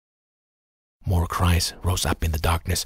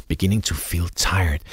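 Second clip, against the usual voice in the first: ordinary delivery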